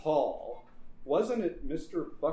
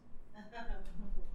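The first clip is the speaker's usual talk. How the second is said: high villain laugh; 7 semitones higher, 3 dB quieter than usual. Acted. Laugh before the shove.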